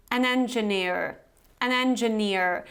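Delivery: engineer in an American accent, R sounded at the end; American engineer with a clear final R; American variety. Shown as a demonstration. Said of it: "you actually pronounce this R at the end".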